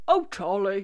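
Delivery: grandad voice. Retold or imitated. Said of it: "What do you say?".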